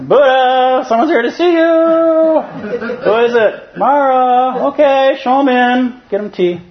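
very high, loud cartoon voice; high squeaky Ananda. Ananda calling to Buddha that Mara has come, very high and loud. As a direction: squeak it out like a flustered attendant. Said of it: "Ananda and Buddha dialogue".

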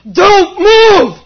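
sudden loud barked command; shouted order. Retold or imitated. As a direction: yell it like a strict Zen teacher. Teacher's order retold.

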